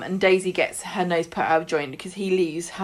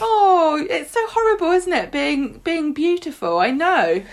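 high, hammed-up cooing; mock gushing sympathy; 9 semitones higher, 4 dB louder than usual. Jenny comforting Daisy, high mocking coo. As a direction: gush over her like a fool. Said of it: "And Jenny's like".

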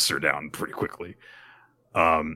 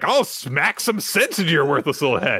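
mock threat; 8 semitones higher, 3 dB louder than usual. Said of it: "the woman starts to go after her and is like".

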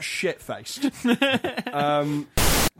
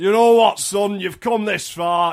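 louder, declaring dad voice; imitated dad; 3 semitones higher, 6 dB louder than usual. Dad announcing to his small son it's Lego time, imitated fatherly declaration. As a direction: put on a proud father's pronouncement. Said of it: "if this is my dad".

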